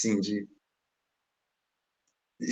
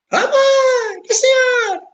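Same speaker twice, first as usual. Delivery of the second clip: mock high girl's voice, much higher; imitating a girl; 4 dB louder, much slower than usual. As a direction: squeak it like the little daughter. Filha chama a mãe. (voz de menina bem aguda, imitada)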